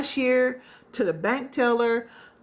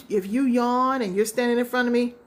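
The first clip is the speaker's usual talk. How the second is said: usual speaking voice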